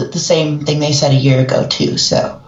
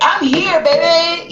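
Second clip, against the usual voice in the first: high, showy arrival line; cocky cartoon entrance; 11 semitones higher, slower than usual. Emperor's New Groove kicking in the door, showy high entrance. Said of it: "He's like".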